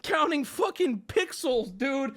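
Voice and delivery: usual speaking voice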